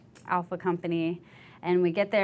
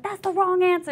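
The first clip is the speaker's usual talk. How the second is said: high, loud rebuke; mock yell; 12 semitones higher, 6 dB louder than usual. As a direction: snap back at her. Drill sergeant yelling.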